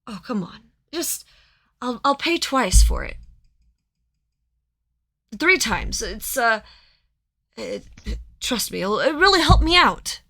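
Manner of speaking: eager pushy merchant, pitch climbing high; merchant pleading. Acted. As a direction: plead and wheedle like a desperate buyer. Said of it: "human gentleman who's like".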